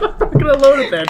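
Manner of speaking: ordinary talk, nothing marked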